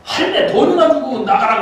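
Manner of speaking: lightly hammed-up rough demand; rough veteran. (가볍게 흉내 낸 거친 요구)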